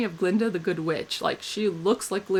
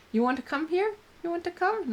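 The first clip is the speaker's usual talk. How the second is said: pet talk; 10 semitones higher, 3 dB quieter than usual. Real, not acted. Talking to her dog.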